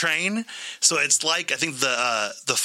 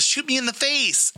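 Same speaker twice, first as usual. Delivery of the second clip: high-pitched, goading demand; imitated eager dare; 11 semitones higher than usual. Maze actor daring the wife to fire, imitated eager dare.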